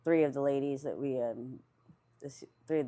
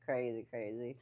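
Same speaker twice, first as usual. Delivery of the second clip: real laughter bursting between words; laughing; 6 dB louder than usual. Real, not acted. Amused by crazy old memories, laughing. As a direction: crack up while getting the words out. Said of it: "that I'm laughing".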